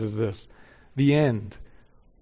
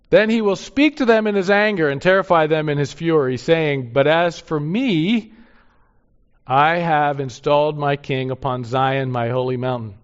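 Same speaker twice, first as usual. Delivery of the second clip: scripture reading; 2 semitones higher, 7 dB louder than usual. Acted. Reads God's angry words.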